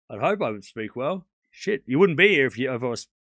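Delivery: usual speaking voice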